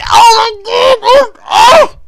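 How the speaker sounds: high, loud crying wail; wild wail. Retold or imitated. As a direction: let out a huge anguished wail. Imitating the wail heard in the hall.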